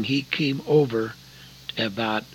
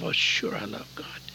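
whispered, softer private thought; inner whisper; 3 semitones lower, 7 dB quieter than usual. Retold or imitated. Answering the evangelist silently to himself, whispering. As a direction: mutter it to yourself, defensive.

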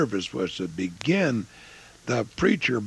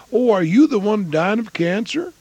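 1 tactless blurt; 13 semitones higher, 8 dB louder than usual. Retold or imitated. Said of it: "Wasn't that a nice approach?".

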